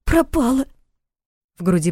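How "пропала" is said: put-on sobbing boy. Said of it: "всхлипнул и часто задышал".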